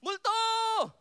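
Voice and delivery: terrified shout. Acted. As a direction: shriek as if seeing a ghost. Disciples see ghost on water.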